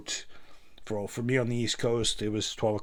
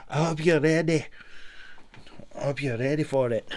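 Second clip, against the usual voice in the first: higher, louder Shrek-style Scottish voice; Elvis doing Shrek; 5 semitones higher, 5 dB louder than usual. Retold or imitated. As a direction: do a Mike Myers Shrek impression. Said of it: "he's the Mike Myers Shrek impersonator".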